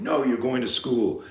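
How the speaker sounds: imitating a nagging, threatening dad; lightly hammed-up parental threat; mock threatening parent. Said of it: "threatening you or going".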